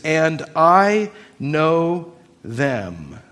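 "I know them" drawn out slowly, weight on "I"; emphatic stress. Shown as a demonstration. Preacher showing where the verse's emphasis lies, slow stress on "I know them". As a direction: stretch "I know them" word by word. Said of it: "The emphasis in this sentence is".

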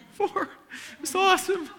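laughing. Real, not acted. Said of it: "laughing through tears".

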